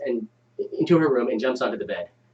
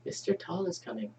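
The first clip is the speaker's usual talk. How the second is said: creepy whisper; 4 semitones higher, 13 dB quieter than usual. Acted. Child whispering creepy line.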